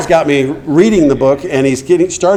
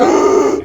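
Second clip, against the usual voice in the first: retold gasp. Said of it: "And I went".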